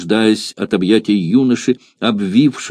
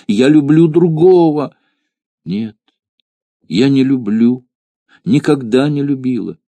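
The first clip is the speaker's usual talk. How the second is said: spoken ardently; 3 semitones higher than usual. A narrator's reading. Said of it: "горячо произнесла девушка".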